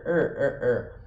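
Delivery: "er" with the tongue curled back, retroflex. Shown as a demonstration. Teacher showing the interior R sound. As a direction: roll the tongue back and hold "er". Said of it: "a língua enrola pra trás".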